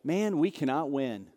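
low, soft exasperated aside; resigned disciple. Acted. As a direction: grumble in mock defeat. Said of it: "You gotta be like".

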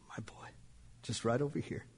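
hushed, voiceless whisper. Acted. Preacher telling how the gunmen placed his son, whispering. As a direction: let it drop to a breath.

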